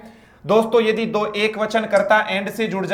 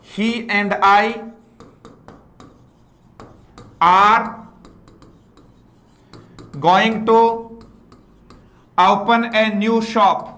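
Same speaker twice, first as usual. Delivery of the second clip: very slow, word by word, with long pauses; slow dictation; much slower than usual. Shown as a demonstration. Teacher dictating to students, slowly. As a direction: spell the sentence out for the class. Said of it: "teacher dictates sentence word by word".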